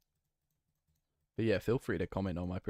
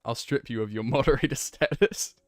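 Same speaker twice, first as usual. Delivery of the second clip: mock Dracula-Arnold threat; 4 semitones higher, 10 dB louder than usual. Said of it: "mix Dracula from Hotel Transylvania with Arnold".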